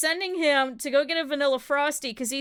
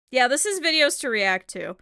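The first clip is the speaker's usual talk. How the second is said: normal talking voice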